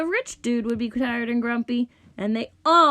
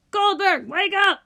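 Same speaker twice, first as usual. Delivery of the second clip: loud, high shout; calling out to wake someone; 8 semitones higher, 5 dB louder than usual. A narrator's reading. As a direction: yell to rouse a sleeper. Rich dude calls sleeping musician.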